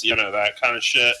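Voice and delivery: ordinary talk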